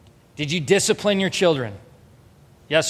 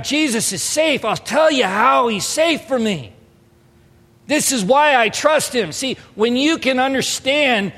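raised, slow pulpit emphasis; sermon build, higher and slower; preaching; 6 semitones higher, 3 dB louder, slower than usual. Acted. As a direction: preach it up, drawn out.

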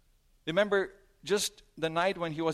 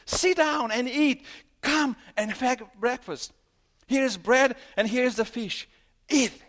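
loud, high preaching of Jesus' words; 7 semitones higher, 7 dB louder than usual. Acted. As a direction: call him warmly but at full force.

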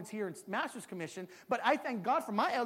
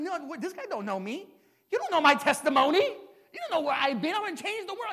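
retold angry protest; 6 semitones higher, 8 dB louder than usual. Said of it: "And I'm angry".

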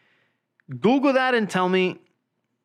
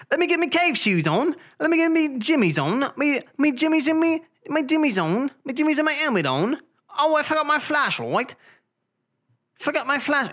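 high, hammed-up British accent; fussing in a silly British accent; high-pitched mock British character; 9 semitones higher than usual.

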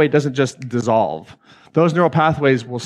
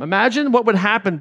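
ordinary delivery

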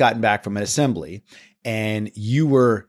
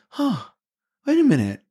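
dawning doubt. Retold or imitated. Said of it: "I was just like".

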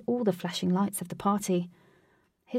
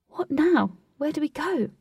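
higher, pushy questions; impatient; 7 semitones higher, 3 dB louder than usual. Acted. Katy pressing Wraith to leave, impatient. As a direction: hurry him up, she wants to go. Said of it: "Katy asked, impatiently".

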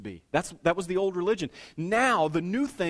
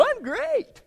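much higher, bright put-on "I'm great"; fake cheerful. Churchgoer forcing a cheery reply to a greeter, fake bright. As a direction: sell a phony happy answer.

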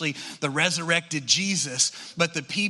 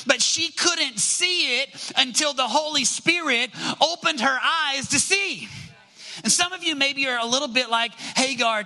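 rising sermon, pressing each line; preaching at full force; 7 semitones higher than usual. Acted. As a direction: preach it to the congregation, building passion.

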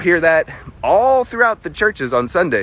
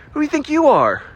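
indignant challenge; 9 semitones higher than usual. Acted.

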